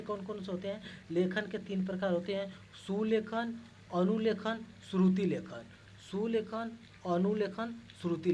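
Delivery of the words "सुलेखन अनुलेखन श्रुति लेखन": loud, even list with each word stressed; teacher listing. Shown as a demonstration. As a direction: drill the three words one by one for the class. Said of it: "listing writing types to students".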